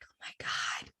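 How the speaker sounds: breathless whispered oh my God; gasped whisper. Retold or imitated. Watching the blank letter opened, a stunned whisper. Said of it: "I was like".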